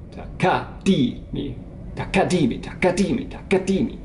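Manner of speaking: "Takadimi" with both middle syllables accented high; stress on KA and DI. Shown as a demonstration. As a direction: chant taKADImi, punching "ka" and "di". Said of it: "Or the middle two".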